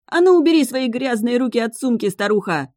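высокий громкий визг на старуху; визжит на уборщицу. A narrator's reading. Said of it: "завизжал он". (истеричный визг)

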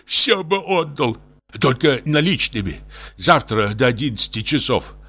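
high, desperate pleading; tipsy despair. A narrator's reading. Professor offers his library for a coat, despairing high plea. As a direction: wail and bargain in despair. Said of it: "вскричал пьяненький профессор в величайшем горе".

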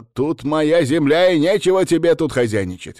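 high, loud, commanding scolding; angry territorial rebuke. A narrator's reading. Woman warning guest off her land, angry. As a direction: drive an intruder off with force.

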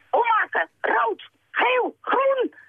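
very high, urgent calls; high-pitched story-book shouts; calling out. A narrator's reading. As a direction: cry out each word separately, pitched high. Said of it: "riep hij tegen Saar".

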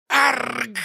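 high, loud pained roar; demon roar. Acted. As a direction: bellow in agony like a beast. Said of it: "взревел демон".

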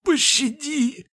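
high, slow, drawn-out whimper; whining plea. Acted. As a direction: whine for mercy like a beaten dog. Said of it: "заскулил он".

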